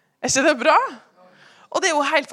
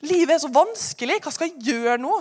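higher, whiny sigh of self-pity; mimicking a despairing person, much higher; mock whining; 5 semitones higher than usual. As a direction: moan it like life is too hard. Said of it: "så blir det en sånn derre".

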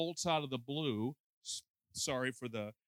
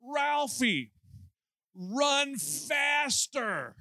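much higher, louder, very slow yell; mom yelling; 11 semitones higher, 5 dB louder, much slower than usual. Retold or imitated. Mother in the stands urging her son on, loud drawn-out yell. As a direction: bellow it from the stands at your kid. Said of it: "this mom stands up and goes".

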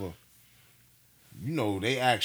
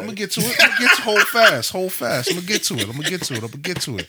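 high, very loud, insistent hold-off; real shouted protest, easing toward the end; loud playful protest; 9 semitones higher, 11 dB louder than usual. Real, not acted. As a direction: cut the co-host off loudly, pleading for patience. Cutting off co-host's question.

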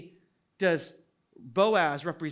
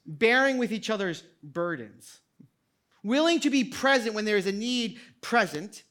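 ordinary talk, nothing marked

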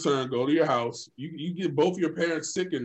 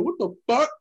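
higher, startled what the fuck; shocked outburst; 9 semitones higher than usual. Retold or imitated. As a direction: blurt it out in shock. Shocked at chipped tooth.

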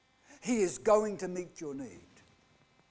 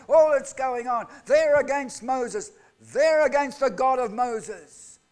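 louder, driving sermon delivery; preaching at full force; 6 semitones higher, 9 dB louder than usual. Acted. Preacher stirring the congregation about grumbling Israelites, with loud forceful preaching. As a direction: preach it hard, building the outrage.

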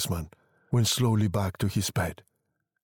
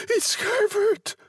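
very high, stretched-out cry; screaming old woman; 3 dB louder, slower than usual. A narrator's reading. Mother sure her son is back, high scream. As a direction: call out the name high and slow. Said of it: "she screamed".

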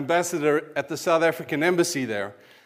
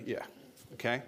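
sceptical listeners; 5 semitones lower, 16 dB quieter, faster than usual. Retold or imitated. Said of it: "I hear you say".

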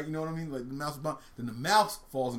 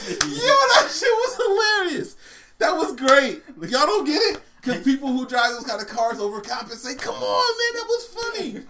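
high, loud disbelief through laughter; real laughing, indignant outcry; loud protest; 13 semitones higher, 9 dB louder, slower than usual. Real, not acted. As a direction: shout that your joke deserved better. Defends joke to unimpressed hosts.

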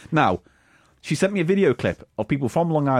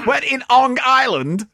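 Long Island accent; 9 semitones higher, 4 dB louder, slower than usual. Shown as a demonstration. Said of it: "at least do it right".

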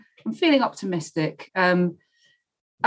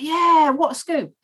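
high, slow, hammed-up Cockney; drawn-out mock Cockney enthusiasm; comedy Cockney accent; 11 semitones higher, 4 dB louder, slower than usual. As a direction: crow it in comic Cockney. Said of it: "my, my comedy Cockney accent".

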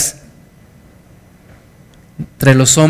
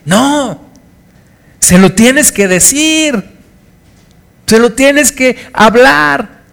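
higher, slow and emphatic preaching; fervent insistence; 9 semitones higher, slower than usual. Real, not acted. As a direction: press it home to the congregation. Pastor insiste fervorosamente. (insistencia fervorosa, aguda y lenta)

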